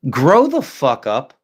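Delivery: slow, deadpan put-down; blunt dismissal. Retold or imitated. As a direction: say it flat and slow, like you're done. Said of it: "I just look at them like".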